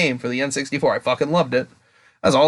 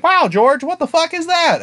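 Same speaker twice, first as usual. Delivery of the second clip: mock fan outrage; slower than usual. Fan complaining to George Lucas, high mock disbelief. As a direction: squeal it like a betrayed fan. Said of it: "there's a lot of, like".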